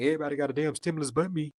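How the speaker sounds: fast, fuming ex; mock grumbling baby daddy; imitating the ex. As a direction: rattle off the ex's complaint. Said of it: "He was like".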